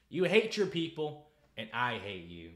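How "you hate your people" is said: loud, slow accusation; slow loud scolding; angry accusation. Real, not acted. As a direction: spit it at him slowly. Ranting at Justin.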